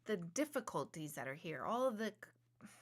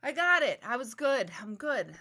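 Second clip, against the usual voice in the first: mock triumph; 3 semitones higher, 9 dB louder than usual. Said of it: "so I can just be like".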